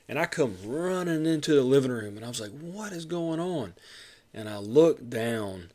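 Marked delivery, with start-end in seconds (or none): 2.5-3.7 high, slow alarmed question; rushing in at a scream; retold panic. Runs in after wife's scream.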